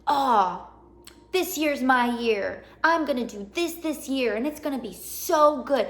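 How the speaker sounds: lightly voiced, breezy new-year optimist; a touch of naive cheer, slow on this year's my year; mock hopeful resolution. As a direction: toss it off like an empty promise you always make. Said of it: "how many times are you gonna say".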